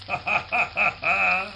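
sinister amused laugh; a short cruel laugh; villain laugh. Acted. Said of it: "Kryzak laughed".

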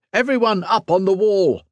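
high-pitched rallying call; shouted order. A narrator's reading. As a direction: call all the soldiers to their posts. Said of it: "he shouted".

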